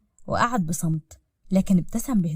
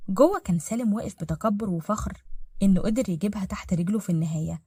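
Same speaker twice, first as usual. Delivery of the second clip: usual speaking voice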